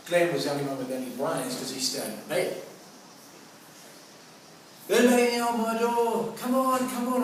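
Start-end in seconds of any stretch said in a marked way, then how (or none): 4.9-7.2 high, loud mock urgent calling. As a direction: hammer on the door like impatient mates. Friends waking him at dawn.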